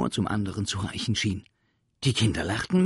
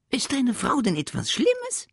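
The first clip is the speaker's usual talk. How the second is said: cheeky creature; 5 dB louder than usual. Acted. Sams asks cheekily.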